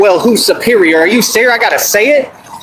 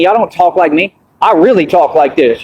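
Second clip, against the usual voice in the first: ordinary talk, nothing marked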